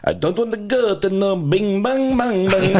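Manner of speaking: high, slow hammed-up Jamaican accent singing; mock Jamaican accent. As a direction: sing it in a bad fake Jamaican accent. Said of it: "speak in a Jamaican accent".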